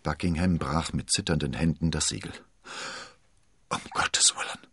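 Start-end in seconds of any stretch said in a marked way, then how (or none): 3.7-4.7 horrified whisper, all breath; hushed, voiceless exclamation; toneless whisper. A narrator's reading. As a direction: whisper it without voice, stunned by what was read. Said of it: "flüsterte er tonlos".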